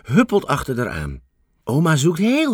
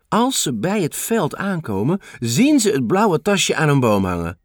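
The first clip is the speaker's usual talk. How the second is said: ordinary delivery, nothing marked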